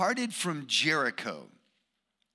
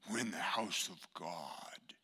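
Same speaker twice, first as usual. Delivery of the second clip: slow, stern whisper; reverent, scolding whisper; hushed reproof; 11 semitones lower, 10 dB quieter than usual. Acted. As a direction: whisper it as if in a sacred place. Acting a churchgoer hushing children.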